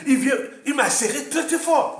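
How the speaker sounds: high, loud, worked-up account; agitated retelling. Real, not acted. Raconte l'étreinte qui l'a effrayé. (récit agité, voix haute et forte)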